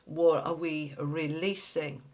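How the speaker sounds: usual speaking voice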